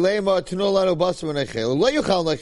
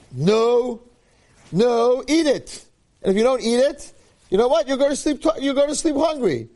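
stern stepmother; 8 semitones higher than usual. Retold or imitated. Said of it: "she's like".

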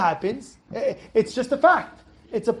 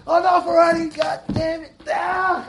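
high-pitched, strained outburst; angry father; 5 semitones higher than usual. Acted. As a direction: act out a dad losing his temper. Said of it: "you ever see a guy getting angry".

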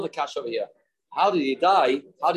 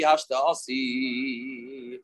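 drawn out very slowly, softer and lower; longing sigh; 3 semitones lower, 4 dB quieter, much slower than usual. Retold or imitated. Wife waiting at the window, a slow longing murmur. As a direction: let the wife's yearning stretch each syllable. Wife anxiously awaiting husband.

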